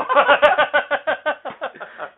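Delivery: high, long real laugh; big laugh. Real, not acted. Host laughs hard at his co-host's pun, real laughter. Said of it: "Well done, sir".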